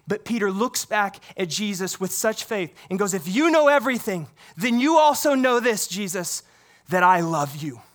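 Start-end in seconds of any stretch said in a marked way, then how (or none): 3.1-7.8 louder, pleading declaration of love; earnest declaration. Acted. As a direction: say it to Jesus with full faith, rising. Peter's impassioned declaration voiced.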